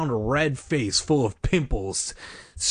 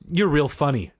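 mildly sarcastic, pitched up; 4 semitones higher than usual. A narrator's reading. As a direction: feign being impressed, with sarcasm. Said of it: "Joey said sarcastically".

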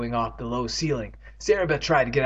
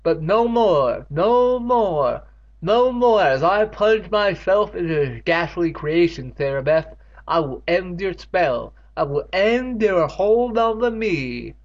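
higher, louder, slow melodramatic vow; hammed-up defiance; 6 semitones higher, 5 dB louder, slower than usual. Catherine defying Sarah Beth, higher hammed-up vow. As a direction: declaim it like a doomed heroine.

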